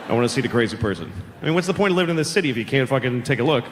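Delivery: ordinary delivery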